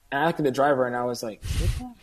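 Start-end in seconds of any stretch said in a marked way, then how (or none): 1.4-2.0 Kidnapped? pitched much higher with a rising question; mouthed in surprise. Retold or imitated. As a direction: mouth Kidnapped? back, eyebrows-up incredulous. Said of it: "mouthed it to each other".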